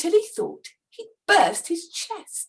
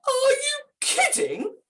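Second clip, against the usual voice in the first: disbelieving; 11 semitones higher than usual. A narrator's reading. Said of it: "Santa responded".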